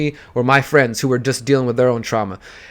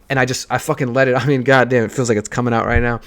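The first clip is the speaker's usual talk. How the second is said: ordinary delivery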